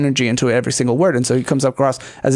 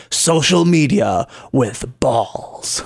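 drawn-out slogan; 2 semitones lower, much slower than usual. Acted. Reads out a slogan quote with flourish.

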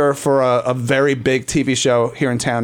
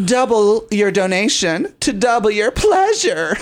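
high, theatrical, laughing sales line; playful over-the-top appeal breaking into laughter; campy pledge pitch; 6 semitones higher than usual. Pretend. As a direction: ham up the innuendo, pitched high. Campy pledge pitch in unison style.

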